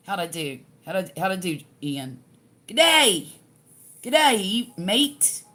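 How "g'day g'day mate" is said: a loud Southerner's try at an Australian "G'day, mate"; attempted Australian accent. Southern host tries an Aussie greeting for Ian, in a hammed-up Australian accent. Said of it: "I'm too Southern to do that".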